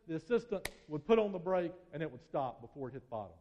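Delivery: ordinary talk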